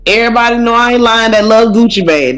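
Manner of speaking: ordinary talk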